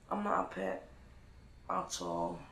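barely voiced, defeated; quiet deflated. Real, not acted. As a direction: let it die away. Fading after her outburst.

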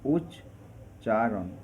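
slow উচ্চারণ with a clear gap, উচ্... চারণ; syllable split. Shown as a demonstration. Teacher shows how the word breaks, syllable split. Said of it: "দলটিতে কয়ের ভাঙলে কি হবে".

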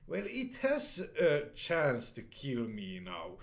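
ordinary talk, nothing marked

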